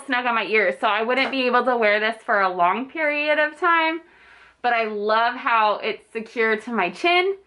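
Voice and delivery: muffled through cloth mask; speaking through a face mask. Real, not acted. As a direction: mouth covered by fabric. Seen 5: pink patterned cloth mask covers mouth throughout labelled words.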